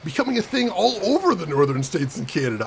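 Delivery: normal talking voice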